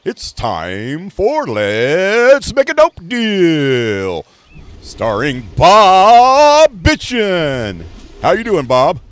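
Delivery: booming, high, drawn-out host voice; very loud, very slow over-the-top showman; game show host. Retold or imitated. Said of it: "he talked like that".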